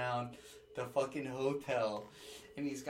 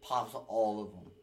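normal talking voice

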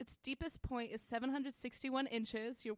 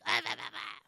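hammed-up French snob babble; mock French accent, babbling with disdain; pretentious French. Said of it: "the most pretentious French bitch".

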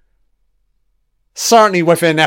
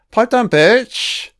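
"Pipe down, bitch" drawn out slowly, higher; slow, higher mock-tough "Pipe down, bitch"; slow mock tough guy; 7 semitones higher, slower than usual. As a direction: stretch every word of "Pipe down, bitch" slowly. Mocking a TV character.

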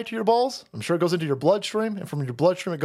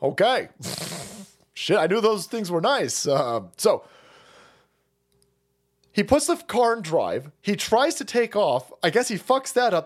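ordinary talk

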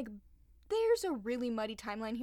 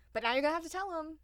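fast aside; 6 semitones higher, 4 dB louder, much faster than usual. Real, not acted. Joking aside to parents.

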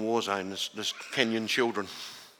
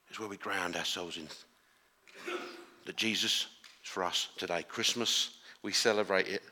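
ordinary talk, nothing marked

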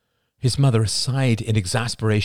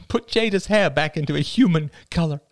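laughing mother; 10 semitones higher than usual. A narrator's reading.

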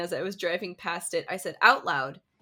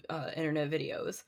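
normal talking voice

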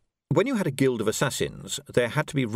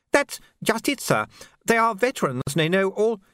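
put-on high, nervous young soldier; urgent panting report; 10 semitones higher than usual. A narrator's reading. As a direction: blurt the bad news anxiously. Messenger explains nervously.

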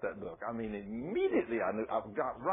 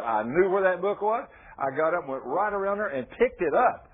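loud, higher testimony preaching; full-force preaching; 4 semitones higher, 7 dB louder than usual. Acted. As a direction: preach it out to the congregation.

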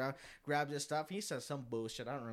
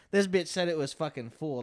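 ordinary talk

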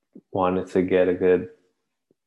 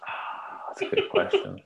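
breathless, whispered laughter through words; real suppressed laughter; wheezing laugh; 13 semitones higher, 5 dB quieter than usual. Real, not acted. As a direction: laugh silently while trying to answer. Choking laugh while answering.

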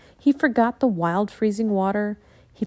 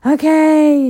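exasperated okay; 7 semitones higher, 12 dB louder than usual. Acted. Jack groans, gives in.

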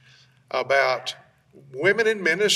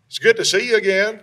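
excited; 7 semitones higher, 7 dB louder, faster than usual. Real, not acted. Cheerful greeting to returning guest.

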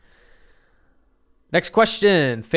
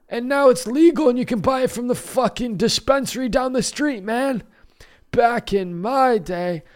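back in my day rant; 5 semitones higher than usual. Pretend. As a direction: rant like a cranky old geezer. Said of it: "You're an old man telling his youngins about back in the day".

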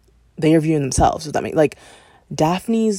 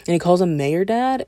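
ordinary talk, nothing marked

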